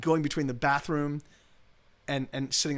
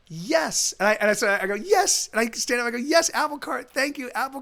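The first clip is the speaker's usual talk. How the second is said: ecstatic yes; 7 semitones higher, 5 dB louder than usual. Retold or imitated. Reacting ecstatically to the word.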